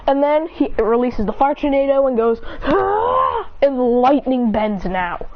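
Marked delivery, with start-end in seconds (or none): 2.7-3.5 high, drawn-out battle yell; attack cry. Acted. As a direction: cry out as you hurl the attack. Acted release of fire tornado.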